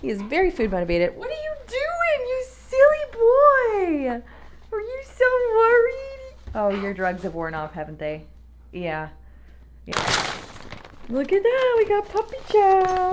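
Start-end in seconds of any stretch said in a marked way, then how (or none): 1.2-6.3 very high, slow cooing to a pet. Real, not acted. Owner coos at her worried dog, high slow baby talk. 11.1-13.1 high, bright-eyed announcement to the dog; excited pet talk. Real, not acted. Owner shows her dog the puppy chow, high excited pet voice. Showing dog his food.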